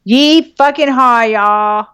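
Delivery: drawn-out, gleeful hoedown holler; rowdy yeehaw. Real, not acted. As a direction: let out a big hyped-up yeehaw. Hyped cowgirl cheer at the reading.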